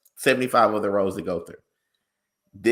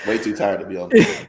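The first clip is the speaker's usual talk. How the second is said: laughing; 5 dB louder than usual. Real, not acted. Laughing while speaking.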